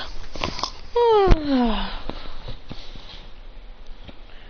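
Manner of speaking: long, falling sigh. Acted. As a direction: let out a long acted sigh. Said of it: "after that he goes".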